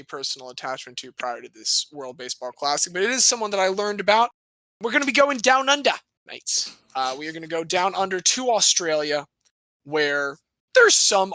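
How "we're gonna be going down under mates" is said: high, playful Aussie lilt; put-on Aussie twang, pitched up; mock Australian accent. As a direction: ham up a brief Australian accent.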